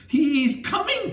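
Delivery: high, slow terrified scream; drawn-out high terrified scream. A narrator's reading. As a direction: scream the warning in horror. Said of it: "Chris screamed".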